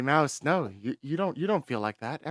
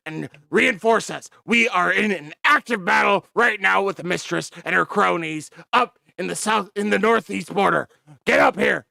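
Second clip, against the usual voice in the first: loud, higher, fast shouted commands; barking orders; 9 semitones higher, 14 dB louder than usual. Acted. Commander ordering the major to send troops. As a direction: yell the orders down the line.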